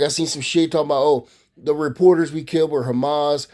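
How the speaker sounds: ordinary delivery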